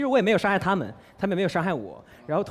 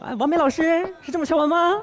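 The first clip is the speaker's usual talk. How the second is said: high-pitched, mock-polite needling; put-on, higher-pitched sarcastic courtesy; sarcastic mother; 8 semitones higher than usual. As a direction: imitate mum being passive-aggressive. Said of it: "但是她会阴阳我".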